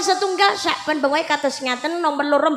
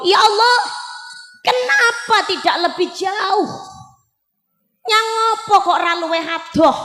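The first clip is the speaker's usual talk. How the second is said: a raised cry to God; dying man's cry; 3 semitones higher than usual. Retold or imitated. Dying husband calling to God, raised cry. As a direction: cry out to Allah, half sung. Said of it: "bojo kula niku bengok".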